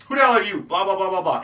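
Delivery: put-on high, loud, dismissive girl trailing into blah; mock the snotty girl, high and loud; bitchy girl. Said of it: "if she says".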